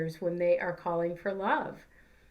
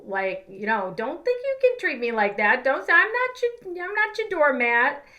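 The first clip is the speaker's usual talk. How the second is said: louder and higher, rising into a hammed-up outraged rant; an exaggerated put-upon outburst, quick at first, climbing high and loud by the end; mock indignant rant; 3 semitones higher, 6 dB louder than usual. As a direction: play the offended adult snapping back, building to a high yell. Imagined angry retort to a baby.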